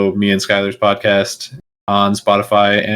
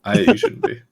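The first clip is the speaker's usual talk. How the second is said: laughing while disagreeing; 10 semitones higher, 3 dB quieter than usual. Real, not acted. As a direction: say it through a laugh.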